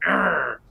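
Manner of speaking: mock growl of irritation; short acted "arr" of frustration; frustrated growl. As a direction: growl it like a bad day getting to you. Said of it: "if you're having a bad day".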